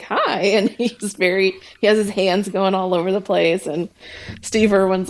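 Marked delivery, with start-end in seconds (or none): 0.0-0.4 a high, nervous little hi; high mock greeting. Ross greets the Crocodile Hunter, high and timid. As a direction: squeak out a timid hello. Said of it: "he's just like".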